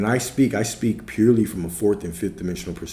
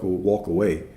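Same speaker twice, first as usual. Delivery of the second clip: ordinary delivery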